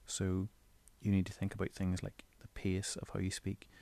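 ordinary talk, nothing marked

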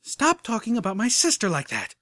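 a protective threat; warning edge. Acted. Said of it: "with an edge of warning in her voice".

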